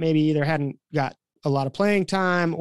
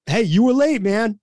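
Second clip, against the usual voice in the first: higher, louder push-back; retold firm comeback; 5 semitones higher, 4 dB louder than usual. New coach standing up to the late player, firm retort. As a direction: stand your ground, lightly acted.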